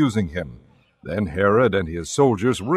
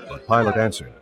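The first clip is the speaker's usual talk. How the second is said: usual speaking voice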